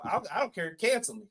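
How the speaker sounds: ordinary talk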